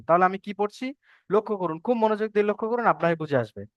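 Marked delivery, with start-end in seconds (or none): none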